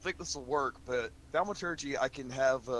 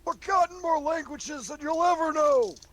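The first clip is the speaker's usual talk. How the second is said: huge, much higher and louder proclamation; booming voice; 7 dB louder than usual. Acted. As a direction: boom it out like a magic-boosted elder. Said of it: "make my voice boom and go".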